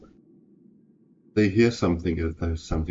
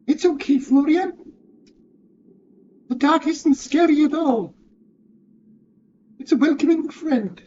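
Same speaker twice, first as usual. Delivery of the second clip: very high, louder comforting goblin; 5 dB louder than usual. Acted. Grimo comforts scared Florian in a high goblin voice. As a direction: soothe him in a squeaky goblin voice. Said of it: "I couldn't find my goblin voice".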